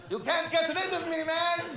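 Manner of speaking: high-pitched holler from a van window; mock defiant yell. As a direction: call out defiantly in an imitated voice. Dad yelling from van window.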